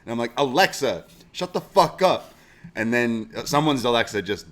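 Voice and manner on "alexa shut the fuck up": mock yell. Retelling yelling at the Alexa.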